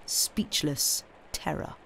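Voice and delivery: plain reading voice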